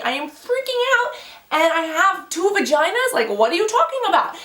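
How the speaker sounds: high-pitched retold disbelief; hammed-up panicked incredulity; freaking out. Reliving shock at diagnosis.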